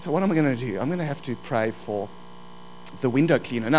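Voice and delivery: ordinary delivery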